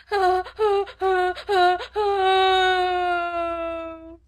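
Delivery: high, loud sobbed "oh"s ending in a long wail; tempestuous howling. A narrator's reading. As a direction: bawl like a small child in a tantrum. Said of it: "Lottie howled tempestuously".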